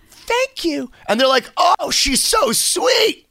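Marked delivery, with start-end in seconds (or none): 0.1-0.9 high-pitched, polite little thank-you; sweet foreigner's thanks. Pretend. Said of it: "just be like". 1.6-3.2 high, drawn-out mock cooing; mock gushing. Voicing charmed Americans in a skit.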